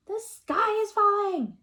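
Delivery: high loud alarm; shrieking the alarm; panicked cry. Acted. Chicken Little crying out in panic, high and loud.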